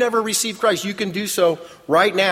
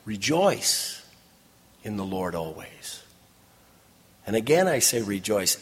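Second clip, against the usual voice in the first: soft, low scripture quote; gentle correction; 9 semitones lower, 7 dB quieter than usual. Real, not acted. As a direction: quietly set the true verse right. True verse against mock groaning.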